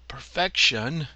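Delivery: loud, slow, high roar. A narrator's reading. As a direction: roar it out as the devil, drawn out. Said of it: "he roared".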